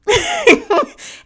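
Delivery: a sudden loud high laugh; laughing out loud at her own joke; real burst of laughter. Real, not acted.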